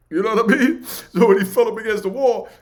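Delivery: loud, high, laughing through the words. Real, not acted. Storyteller laughing loudly at L hitting the wall. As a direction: burst out laughing as you tell it.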